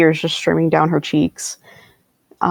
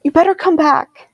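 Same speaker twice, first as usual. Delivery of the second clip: mock shout; 8 semitones higher than usual. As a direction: call after him in a contained shout. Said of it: "Faintus is gonna shout after him".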